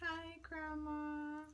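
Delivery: put-on high, very slow, soft little-kid voice; drawn-out tiny "Hi, Grandma"; childlike. Thirty-year-old greeting grandma, in a high, very slow childlike voice. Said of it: "Now, I'm thirty, okay? And I'm still like".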